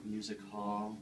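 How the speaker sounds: gentle, soothing painting-show host voice, a Bob Ross send-up; Bob Ross Junior. Acted. Host of a painting show, talking to viewers at home: soothing Bob Ross voice. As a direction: coax the canvas along like a dreamy TV painter.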